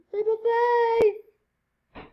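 very slow, high, loud battle cry; dramatic shout. Real, not acted. Gamer shouting as she leaps. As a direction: hold it out like a hero leaping.

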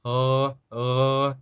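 ר as a long voiced throat sound; voiced het. Shown as a demonstration. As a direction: hold ר with the voice switched on, twice. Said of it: "וח' בקול זה נשמע".

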